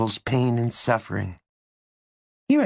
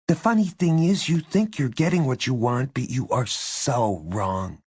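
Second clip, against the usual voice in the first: put-on bitter girl, starting high and settling down; 5 semitones higher than usual. A narrator's reading. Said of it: "twisted into a bitter smile".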